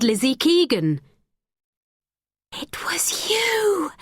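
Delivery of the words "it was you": whispered, slow and much higher; hushed whisper of sudden recognition; breathed. A narrator's reading. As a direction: breathe it out in horrified realisation. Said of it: "she breathed".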